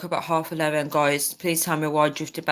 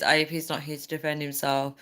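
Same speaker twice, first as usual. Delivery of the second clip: ordinary talk, nothing marked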